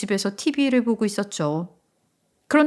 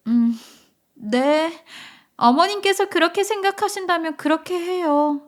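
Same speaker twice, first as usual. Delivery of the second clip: high, polite younger voice; agreeable daughter-in-law; 6 semitones higher than usual. Acted. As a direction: accept it politely in a raised pitch. (높고 공손한 며느리 목소리)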